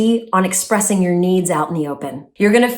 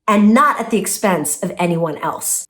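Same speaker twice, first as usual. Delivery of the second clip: ordinary talk, nothing marked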